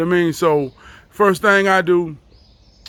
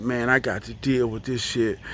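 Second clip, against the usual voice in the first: under his breath, worn down; low, soft defeated mutter; 6 semitones lower, 7 dB quieter than usual. Retold or imitated. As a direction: sigh it out to yourself, lower and softer. Power out again, defeated.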